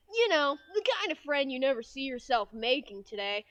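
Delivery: lightly voiced game protagonist; Scrimp. Mascot explains an old friendship, lightly put-on character voice. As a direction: narrate with a hint of character. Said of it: "closest I can do to that voice".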